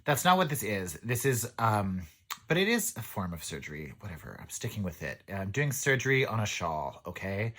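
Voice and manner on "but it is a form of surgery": ordinary talk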